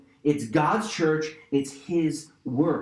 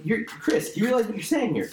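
self-rebuke; 6 semitones higher than usual. Retold or imitated. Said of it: "I'm like".

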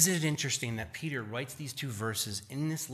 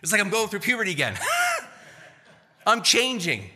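amazed excited outburst; 7 semitones higher, 5 dB louder, faster than usual. Retold or imitated. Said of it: "you're like".

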